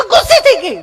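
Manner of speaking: very high, loud shriek; mock panic. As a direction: shriek the warning in exaggerated alarm. Frightened visitor repeats the alarm.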